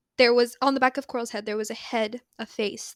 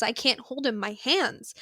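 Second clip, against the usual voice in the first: normal talking voice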